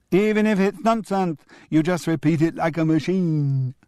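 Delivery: put-on high crowing child echo. Julian crowing his grandfather's words back, high. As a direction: crow it back triumphantly like a kid. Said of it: "crowed Julian".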